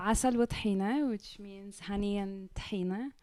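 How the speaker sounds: ordinary delivery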